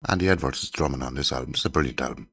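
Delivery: ordinary talk, nothing marked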